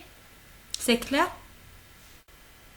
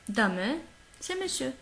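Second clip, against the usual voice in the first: ordinary delivery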